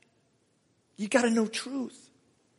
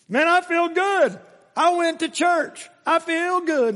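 higher and slower, mock contented churchgoer; 10 semitones higher, 4 dB louder than usual. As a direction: parody a smug feel-good believer, drawn out. Mocking a self-satisfied churchgoer.

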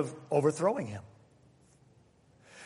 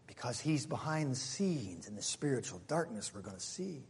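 hushed; 6 semitones lower, 11 dB quieter than usual. Acted. Preacher drops into hushed aside.

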